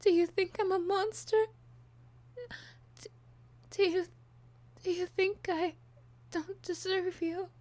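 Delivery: high, loud, tearful breakdown; sobbing, begging, voice breaking high; unhinged villain. Acted.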